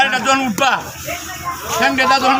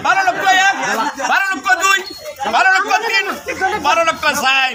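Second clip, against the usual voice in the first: auctioneer; 4 semitones higher than usual. Acted. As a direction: call out the bids like an auctioneer. Auctioneer repeats bid.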